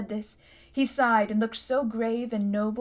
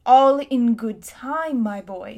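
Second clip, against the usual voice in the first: firm interruption; 8 dB louder, slower than usual. A narrator's reading. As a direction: cut the boy off with unhurried authority. Uncle cuts off the impatient boy.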